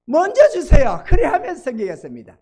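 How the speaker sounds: loud, high mock plea; insistent bargaining cry; demanding petitioner. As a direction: act out people insisting God give first. Acts people demanding first.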